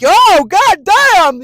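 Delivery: high, very loud, slow startled cry; scared voice jump. Retold or imitated. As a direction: let the voice leap up in fright. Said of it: "her voice will go from here to here".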